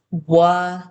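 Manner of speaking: Wa-n-s sounded out slowly, sound by sound; sounding out words. Shown as a demonstration. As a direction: segment Wa-n-s into its sounds. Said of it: "now we have the word once".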